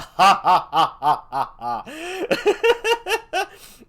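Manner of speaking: loud, high, drawn-out cackle; maniacal laugh. Acted. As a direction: gloat in a long, loud, rising cackle. Gloating over his own ego.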